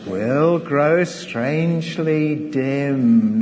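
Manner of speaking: slow, drawn-out recital with long pauses; hymn recitation. Acted. Preacher quoting an old chorus to the congregation, slow recitation. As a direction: linger on each phrase of the chorus.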